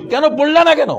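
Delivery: loud, high accusing question; interrogating the sinner loudly; stern demand. Acted. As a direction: demand an answer like a judge. Preacher voices God's interrogation.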